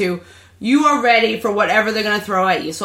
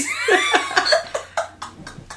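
long high-pitched laugh; high laughter; 4 dB quieter than usual. Real, not acted. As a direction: squeal with laughter. Laughing fit.

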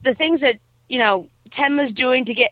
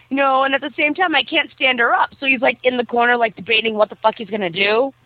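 ordinary talk

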